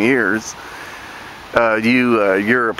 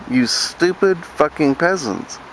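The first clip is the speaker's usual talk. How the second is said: ordinary delivery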